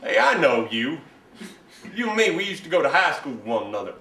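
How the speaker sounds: excited recognition. Acted. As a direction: blurt it out as recognition dawns. Recognizes old high school classmate.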